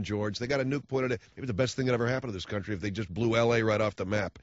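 ordinary talk, nothing marked

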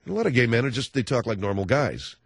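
usual speaking voice

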